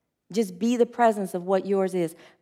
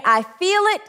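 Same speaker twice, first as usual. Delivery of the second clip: higher-pitched demo; 9 semitones higher than usual. Shown as a demonstration. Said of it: "I say".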